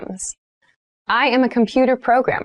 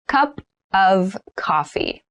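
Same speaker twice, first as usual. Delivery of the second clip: slow, careful phrase for learners; slow model read; slower than usual. Shown as a demonstration. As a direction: say it slowly for learners to repeat. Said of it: "teacher models phrase, third take".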